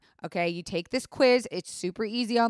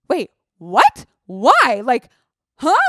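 louder, startled disbelief; alarmed confusion; 4 semitones higher, 10 dB louder, slower than usual. Retold or imitated. Hearing the hospital say go now, startled loud disbelief. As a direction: blurt it out thrown off guard.

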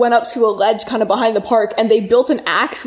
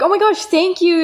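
put-on squeaky delighted girl voice; high girly gush; 8 semitones higher than usual. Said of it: "they were like".

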